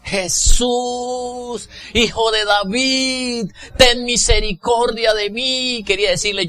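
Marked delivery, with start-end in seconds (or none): 0.0-1.7 high, drawn-out cry for Jesús; first high plea, held long. Acted. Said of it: "empieza a gritar". 1.9-5.8 high, drawn-out beggar's cry; imploring shout pitched high. Acted. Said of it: "empieza a gritar".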